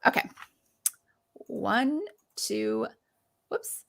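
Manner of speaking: ordinary talk, nothing marked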